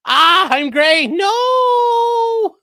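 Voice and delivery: high, loud hammed-up wail; reading chat in a hammed-up wail; mock anguish. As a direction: fake despair with a long cry. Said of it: "Alex says".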